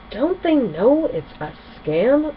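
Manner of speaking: can't believe ponies fall for it; disbelief. A narrator's reading. Rainbow Dash to friends about the scam, in disbelief. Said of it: "said in disbelief".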